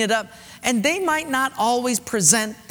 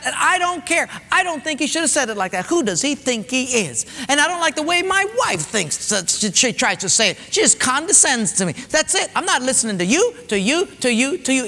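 high, fast whiny griping; whiny mock complaint, pitched up; mocking complainer; 5 semitones higher than usual. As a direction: mimic a petulant churchgoer who won't listen. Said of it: "But God is saying".

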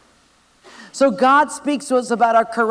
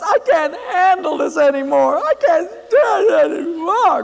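put-on boy sobbing to his grandpa; crying boy; 9 semitones higher, 6 dB louder than usual. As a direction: break down and plead. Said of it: "he came crying to Grandpa".

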